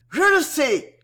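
put-on smug boy crying out that he knows; eager exclamation. A narrator's reading. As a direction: blurt it out to outdo the rival. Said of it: "Votini s'écria avec un sourire".

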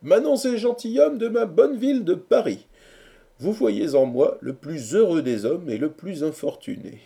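high, loud mock-grand address; speechmaking. A narrator's reading. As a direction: proclaim it to the assembled friends. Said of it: "prononça le discours suivant". (harangue pompeuse d'une voix haute)

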